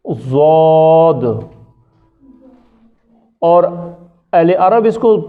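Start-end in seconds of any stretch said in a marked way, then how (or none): 0.1-1.2 ضاد stretched very slowly as "zwaad"; slow teaching ضاد; drawn-out demo. Shown as a demonstration. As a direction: hold ضاد long so students hear it. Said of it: "عجم اس کو پڑھتے ہیں".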